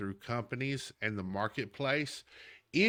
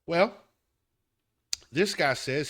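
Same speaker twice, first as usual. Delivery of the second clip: normal talking voice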